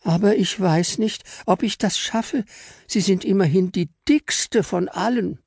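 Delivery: Fräulein Engel hesitantly offering to fly her up; timid little angel. Acted. Engel doubts she can.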